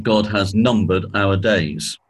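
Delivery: ordinary delivery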